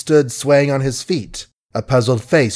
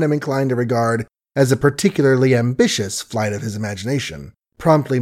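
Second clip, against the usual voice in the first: ordinary delivery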